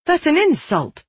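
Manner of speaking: high, offended outburst; indignant protest. Acted. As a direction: protest sharply, voice jumping up. Girl protests low offer.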